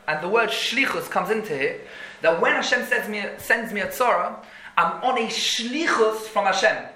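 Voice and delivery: ordinary talk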